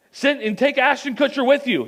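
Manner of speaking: loud, high, exasperated plea; exasperated angel. Acted. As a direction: call for backup in mock desperation. Exasperated angels calling for Jesus.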